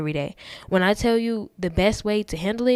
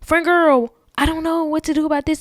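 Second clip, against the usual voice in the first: put-on higher, louder and quicker gushing girlfriend voice; lightly voiced friend-girl, higher and fast; calling a girlfriend; 8 semitones higher, 5 dB louder than usual. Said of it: "calling a friend and being like".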